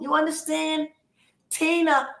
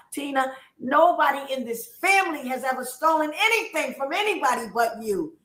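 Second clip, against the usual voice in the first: higher-pitched, emphatic angry accusation; indignant accusation; 6 semitones higher, 3 dB louder than usual. Real, not acted. Woman accusing her sister Tina of stealing, indignant. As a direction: call out the culprit with rising heat.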